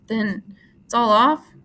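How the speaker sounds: hurt and sulking, dragging the words; sulky. A narrator's reading. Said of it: "Candy asked sulkily".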